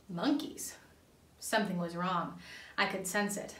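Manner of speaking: plain reading voice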